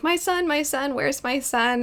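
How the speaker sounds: high, soft mock wail; imitated distraught mother. Retelling a woman searching for her son, mock distraught. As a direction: retell a mother's plea, high but quiet. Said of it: "this poor woman runs out".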